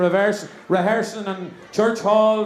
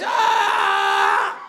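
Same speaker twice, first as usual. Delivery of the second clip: mock howl of pain. Injured man as the sister arrives, mock howl of pain. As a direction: wail like a patient making a racket. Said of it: "What's all this noise?".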